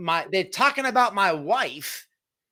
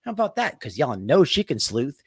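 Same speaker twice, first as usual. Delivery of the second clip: ordinary talk, nothing marked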